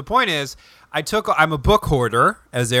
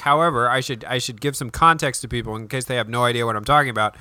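ordinary talk

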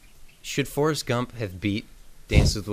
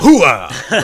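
Pacino's Hooah bark, loud and high; loud gung-ho soldier; 6 semitones higher, 13 dB louder than usual. Retold or imitated. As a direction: shout it like Colonel Slade. Pacino Scent of a Woman catchphrase.

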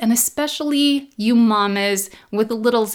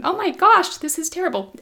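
high, teary laugh at herself; real laugh-cry; laughing through tears; 7 semitones higher than usual. Real, not acted. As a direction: crack up while welling up. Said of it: "try to get it together".

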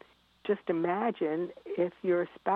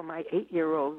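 ordinary delivery

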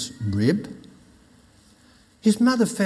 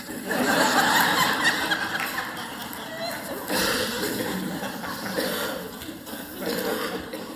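breathy, high burst of laughter; 5 dB quieter than usual. Real, not acted. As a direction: laugh freely at the punchline. Speaker laughs at own joke.

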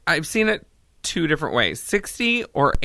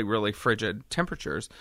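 ordinary talk, nothing marked

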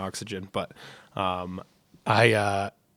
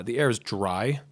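ordinary talk

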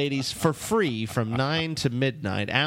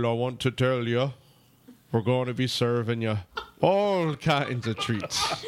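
put-on lilting Irish accent, slowing to a laugh; 3 semitones lower than usual. Host promoting party on request, hammy Irish accent. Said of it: "do it in your Irish voice".

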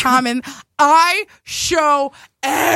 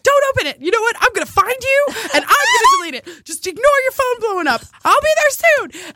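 high frantic begging; panicked pleading; 10 semitones higher than usual. Retold or imitated. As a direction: rush the apology in a panic. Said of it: "panicked retold texts, high pitch".

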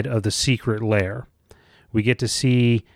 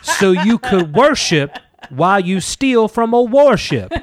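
loud, high, crowing punchline; mock-excited shout on the verge of laughing; hammed-up hype joke; 8 semitones higher, 5 dB louder than usual. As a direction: belt out the gag with mock excitement. Finishing the shouted meme joke.

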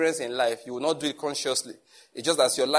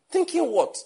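sharply higher, incredulous question; 3 dB louder, slower than usual. Retold or imitated. Pastor cutting in on his secretary in disbelief. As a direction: shoot it back in disbelief. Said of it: "I'm just thinking".

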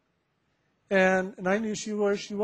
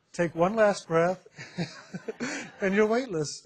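laughing through a joke; 2 semitones higher than usual. Real, not acted. Joke about the instant diet.

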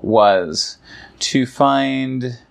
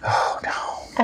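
soft whispered aside; 6 dB quieter than usual. Real, not acted. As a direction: breathe it in a whisper.

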